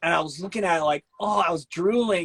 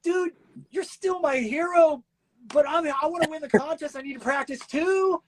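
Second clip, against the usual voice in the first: pleading rookie voice; 11 semitones higher than usual. Retold or imitated. Rookie begging his hero dad, high mock plea. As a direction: whine it up high like a young rookie. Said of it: "And I'm like".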